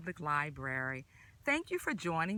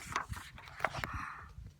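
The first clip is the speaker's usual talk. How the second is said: gasp; 6 dB quieter than usual. Acted. Surprise at next food.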